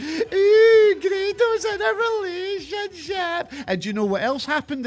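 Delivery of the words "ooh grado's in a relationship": high, drawn-out teasing; teasing coo. Pretend. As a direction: tease him like a playground taunt, slowly. Teases Grado about his relationship.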